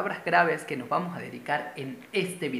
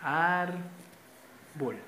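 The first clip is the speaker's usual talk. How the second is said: "Árbol" drawn out as ÁR, pause, bol; split into syllables. Shown as a demonstration. Teacher dividing the example word, "Árbol" syllable by syllable. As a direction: say "Árbol" slowly, one syllable at a time. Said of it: "separémosla en sílaba".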